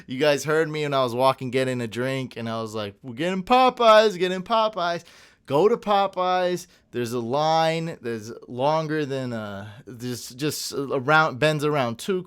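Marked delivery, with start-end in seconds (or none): 3.0-6.7 loud, high chant of the craving; half-yelled, louder and higher; retold yelling. Walking off the live stream to his viewers, a loud playful yell. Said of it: "I screamed it at the end".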